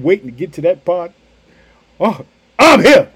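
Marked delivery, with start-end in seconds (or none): 2.0-3.1 reassuring Jesus. Retold or imitated. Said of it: "Did you hear that?".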